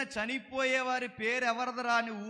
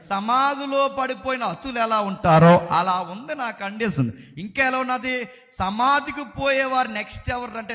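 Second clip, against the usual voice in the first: loud impassioned preaching; preaching at full force; 5 dB louder than usual. Acted. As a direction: preach it out to the church. (బలంగా ప్రసంగం)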